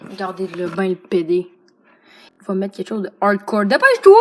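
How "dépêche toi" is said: high, very loud call to hurry; urgent shout. Real, not acted. Player hurrying friend who picks an ingredient, urgent shout. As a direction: shout them into moving.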